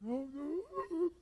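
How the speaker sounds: high imitated sobbing; hammed-up tearful refusal; freaked-out whimper. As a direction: whimper it in mock panic. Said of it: "he's freaking out of his mind".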